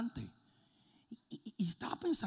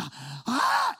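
attack cry; 7 semitones higher, 10 dB louder than usual. Retold or imitated. Attacking the dog.

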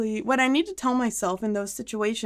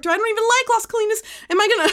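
panicked spiral; 10 semitones higher, much faster than usual. Retold or imitated. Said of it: "And I'm like".

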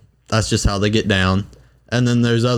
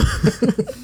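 high, brief laugh; 13 semitones higher than usual. Real, not acted. Laughing at his own story, high laugh. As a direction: let a high laugh slip.

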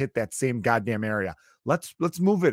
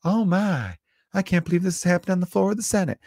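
high, prissy mock Senator; mock Lindsey Graham; 8 semitones higher than usual. Mocks Lindsey Graham on the Senate floor, high and fussy. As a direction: lightly ham a fussy politician aghast. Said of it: "very Lindsey Graham's type of thing".

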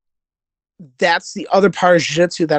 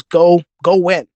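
coach urging; 4 dB louder, slower than usual. Retold or imitated. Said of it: "He goes".

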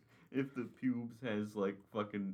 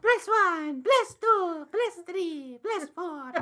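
high game announcer voice; 8 dB louder, slower than usual. Retold or imitated. Counting kills in the game.

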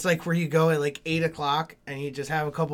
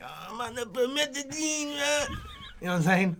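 higher, softer lazy rapper voice; mock rapper; 7 semitones higher, 7 dB quieter than usual. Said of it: "They're like".

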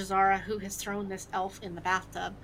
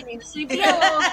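laughing through the line; 7 semitones higher, 12 dB louder, faster than usual. Real, not acted. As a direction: crack up while talking. Laughs mid character line.

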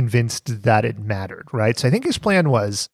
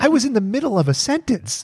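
mock outrage; 10 semitones higher than usual.

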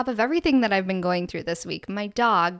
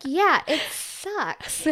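dry agreement; 6 semitones higher, much slower than usual. Retold or imitated. Agreeing with son, mock sympathy.

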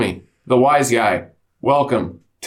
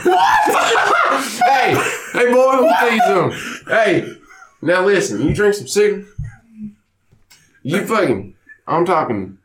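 improv of his dad; 2 semitones higher than usual. Retold or imitated. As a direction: rant like a tipsy country father lecturing his boy. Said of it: "improv of my dad, Walt".

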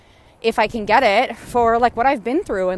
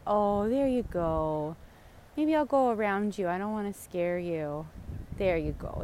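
soft soothing; 4 semitones lower, 9 dB quieter than usual. Real, not acted. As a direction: coax the animal gently, barely speaking. Edging around the goose.

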